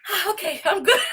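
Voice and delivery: high, bright flip from tears to fine; mock-chirpy sudden recovery; sudden cheeriness. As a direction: snap into cheerful like nothing happened. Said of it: "a little bipolar moment".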